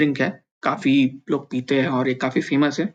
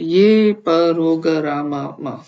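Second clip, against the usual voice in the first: slow spelled-out reading; 4 dB louder, much slower than usual. Shown as a demonstration. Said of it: "धीरे-धीरे पढ़ सकते हैं परोगरामा".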